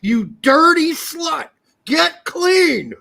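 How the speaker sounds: loud, high, slow furious yell; angry shout. Retold or imitated. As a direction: bellow at the pan, drawn out. Replaying the pan rant.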